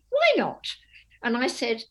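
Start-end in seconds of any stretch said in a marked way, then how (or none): 0.1-0.6 high indignant why. Retold or imitated. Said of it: "She said".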